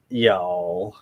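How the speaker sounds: put-on hammy Southern accent drawl; a badly faked Southern accent. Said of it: "Just do a really bad Southern accent".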